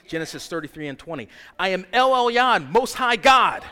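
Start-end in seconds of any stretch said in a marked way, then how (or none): 1.6-3.6 loud ringing name of God; shouted proclamation. Real, not acted. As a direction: cry out the name in triumph. Proclaiming God's names.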